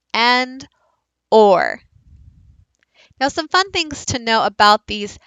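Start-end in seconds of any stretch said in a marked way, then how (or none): 1.3-1.8 "or" isolated as one rounded vowel; clear, emphatic or sound; bossy R sound. Shown as a demonstration. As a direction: say "or" firmly and held. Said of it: "here are the three basics".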